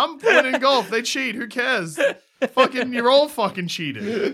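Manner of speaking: high, laughing mock indignation; laughing outrage. Real, not acted. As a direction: rant while cracking up.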